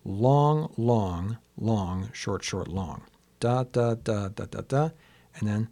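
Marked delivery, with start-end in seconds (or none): 0.1-3.0 LONG, LONG, LONG drawn out, then quicker "short, short," and a final long; measured chant of "long, long, long, short, short, long", stepping down in pitch; metrical demonstration. Shown as a demonstration. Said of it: "So you get". 3.4-4.9 "Da, da, da" held long, then two quick and one long; rhythm demonstration. Shown as a demonstration. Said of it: "so it sounds like this".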